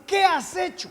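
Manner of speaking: high, loud mock reprimand; retold scolding. Prophet Samuel reprimands King Saul over the sacrifice, retold scolding. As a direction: imitate the prophet taking the king to task. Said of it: "Lo regañó".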